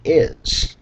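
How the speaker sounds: "is" set apart and breathed out; stress on is. Shown as a demonstration. Reader testing the line for emphasis, stressed "is". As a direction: say "is" as if it carries emphasis. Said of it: "adding emphasis on the word".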